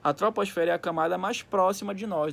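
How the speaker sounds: ordinary delivery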